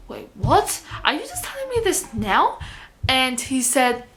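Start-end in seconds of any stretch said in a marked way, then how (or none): 0.1-2.6 incredulous question, pitch leaping up high; retold disbelief. Worker to boss on sudden last day, incredulous. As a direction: re-enact stunned surprise, voice shooting higher. Reacts to sudden job news.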